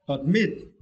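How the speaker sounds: "admit" with "mit" pitched high; teacher's model of "admit", ad-MIT; stress on second syllable. Shown as a demonstration. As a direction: lift and lean on the end of "admit". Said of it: "for this word you need to".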